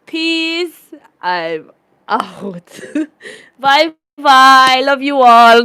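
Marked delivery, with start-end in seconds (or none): 3.6-5.6 loud cheerful sign-off; loud goodbye. Real, not acted. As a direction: call out goodbye loudly. Loud farewell to viewers.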